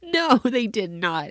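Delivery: high, slow shocked denial; emphatic disbelief. Retold or imitated. Mom refusing to believe daughter's story, drawn-out disbelief. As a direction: stretch the denial in mock outrage.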